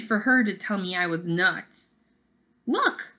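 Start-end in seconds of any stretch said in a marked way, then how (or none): 2.7-3.1 high excited call of Look!; sudden high exclamation; exclaiming. Acted. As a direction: grab attention with a bright Look!. Marisol exclaims.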